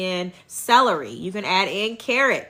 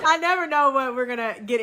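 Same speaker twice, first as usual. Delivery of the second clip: amused; 6 semitones higher than usual. Real, not acted. Amused by chicken paws.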